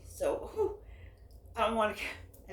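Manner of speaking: short of breath, puffing; catching breath between words. Real, not acted. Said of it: "I catch my breath".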